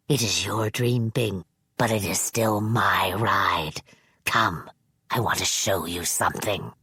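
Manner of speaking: put-on low, whispery sinister old man; a creepy elder confiding a secret. Manx's held voice.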